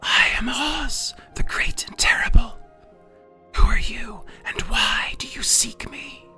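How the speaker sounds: eerie hushed whisper, slow and drawn out; low quiet voice. A narrator's reading. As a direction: whisper like a voice from the fire. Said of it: "quiet voice came from the ball of fire".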